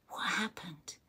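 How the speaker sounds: whisper. Real, not acted. Reacting to a sad message.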